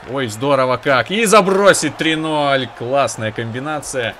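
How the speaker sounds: loud, slow delighted cheer; excited goal reaction. Real, not acted. Goal scored in hockey game.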